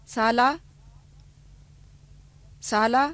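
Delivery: each word said twice with a pause left for learners to repeat; repeat drill. Shown as a demonstration. Teacher leading learners in a repeat-after-me drill. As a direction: dictate clearly, one word at a time. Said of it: "अब आप दोहराइए".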